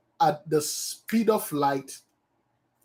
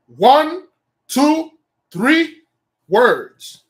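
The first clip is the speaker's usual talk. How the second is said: emphatic count; 8 semitones higher, 10 dB louder, much slower than usual. Acted.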